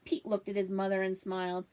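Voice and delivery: ordinary delivery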